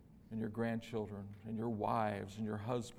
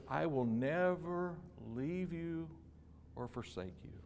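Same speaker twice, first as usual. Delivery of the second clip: tender promise; 5 semitones higher, 4 dB quieter than usual. Acted. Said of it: "God says".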